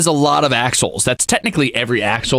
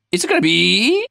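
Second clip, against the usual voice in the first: higher, more eager repeat; teasing suspense, climbing higher; rising suspense; 9 semitones higher than usual. Acted. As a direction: build the suspense up a notch.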